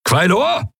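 high, rising call. A narrator's reading. Admiral calling his captain in battle, high shout. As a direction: hail the captain from afar. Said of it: "rief der Admiral".

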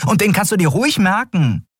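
high-pitched, indignant retort. A narrator's reading. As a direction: bristle in a high companion voice. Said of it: "erinnerte Nelen ihn aufgebracht".

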